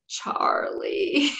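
high, voiceless croak; barely able to talk. Retold or imitated. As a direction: strain out a name with almost no voice. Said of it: "could barely talk".

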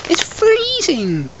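slow high squeak; high squeaky voice. Acted. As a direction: stretch it out up high. Squeaky voice continues.

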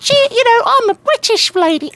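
very high, loud fake-British lady; bad put-on British accent. Gamer mocking Tracer's accent, exaggerated fake British squeal. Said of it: "an American putting on a British accent".

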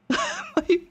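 very high, panicked gasping; terrified. Real, not acted. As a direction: gasp out a word in real terror. Jump scare in horror game.

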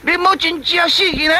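high, worried question to the frog; anxious question. Acted. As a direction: ask if your friend is really dead. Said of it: "三角鱼讲".

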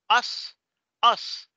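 "us" said high and loud with a hissed voiceless s; crisp final S. Shown as a demonstration. Teacher contrasting it with "is", crisp final S. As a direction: punch out "us", ending on a Spanish-style s. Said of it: "Ahí sí se pronuncia como pronunciamos la S en español".

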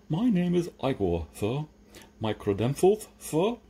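deferential servant with a lisp; lisping servant Igor. Acted. Igor introducing himself to new master, deferential lisp.

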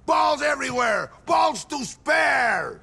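a boss shouting down his timid men; boastful shout. Acted.

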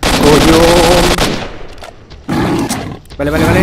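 loud, high startled yell; real jump-scare cry; startled shout. Real, not acted.

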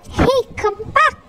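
put-on boy shouting after the guards. A narrator's reading. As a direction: yell for them to come back. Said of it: "Ryan shouted".

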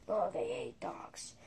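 soft unvoiced aside; whisper. Real, not acted. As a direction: whisper it so nobody hears.

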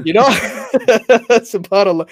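high, loud laugh, then a slow subhanAllah; laughing exclamation. Real, not acted. Laughing at the counselor's shoe advice, laughing.

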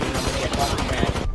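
low, contained warning of anger; simmering anger. Real, not acted. Gamer warning he is getting mad, simmering anger. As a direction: hold the temper in, pitched low.

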